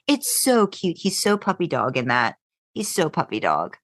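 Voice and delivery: normal talking voice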